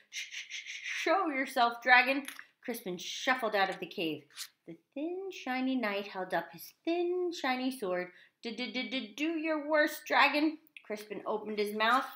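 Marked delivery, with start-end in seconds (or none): 0.1-2.3 stuttered, then higher and louder; squeaky stammering knight. A narrator's reading. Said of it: "squeaked a thin, shiny knight". 8.4-10.6 high, slow stuttered challenge; squeaky stammering knight. A narrator's reading. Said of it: "squeaked a thin, shiny knight".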